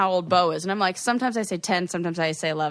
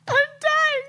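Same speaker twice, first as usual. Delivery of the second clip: laughing. Real, not acted. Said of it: "can't do it with a straight face".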